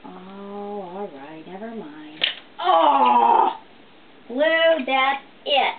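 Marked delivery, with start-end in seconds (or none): 2.6-5.8 startled exclamation. Real, not acted. Parrot does something naughty.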